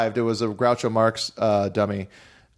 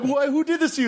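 much higher, hammed-up gasp of alarm; mock shocked. Comic onstage shock at the blackface dummy, hammed-up. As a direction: play up fake horror at the dummy. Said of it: "and like".

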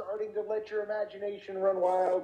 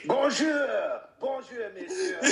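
put-on French accent; 6 semitones higher, slower than usual. Playful French greeting.

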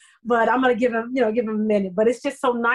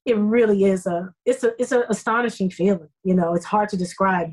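ordinary talk

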